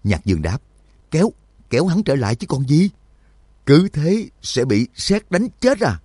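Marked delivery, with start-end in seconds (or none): 1.1-6.0 high, agitated protest; urgent retort. A narrator's reading. Companion wants to drag Binli back, protesting urgently. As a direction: argue back in a raised, urgent pitch. Urgent argument during lightning strike.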